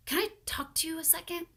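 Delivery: quick whispered question; whispered ask. A narrator's reading. Paul pulls Leslie aside, whispering fast. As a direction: whisper it so no one hears. Paul asks privately, alone.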